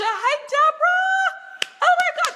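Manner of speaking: high, loud delighted shout of welcome; excited greeting. Real, not acted. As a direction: call out to her, thrilled. Greeting a friend arriving live.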